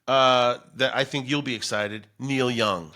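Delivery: ordinary talk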